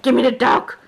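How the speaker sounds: mock-insistent imitation; voicing the demanding little one. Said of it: "This cat's just like".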